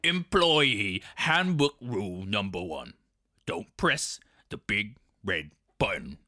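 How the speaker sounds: slow stern warning; growled. A narrator's reading. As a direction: lay down the rule, gruff. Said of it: "Hermes growled".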